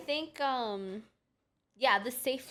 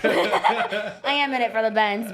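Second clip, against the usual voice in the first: laughing; 11 dB louder, much slower than usual. Real, not acted. Joking about the Benz.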